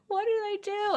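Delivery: very high, small wail; mock anguish. Agonizing over lost friendships, high mock wail. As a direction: wail it softly, right up high.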